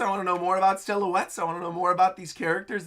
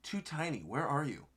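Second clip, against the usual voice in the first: much softer, lower, hushed menace; Loki; 3 semitones lower, 11 dB quieter than usual. Retold or imitated. Loki looking for the escaped one, hushed. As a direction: murmur it like a searching giant. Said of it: "Loki looking on over".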